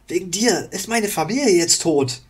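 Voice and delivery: high, loud grief-stricken cry; grieving outburst. Acted. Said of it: "Die Trauer trieb ihm die Worte". (trauernder Aufschrei)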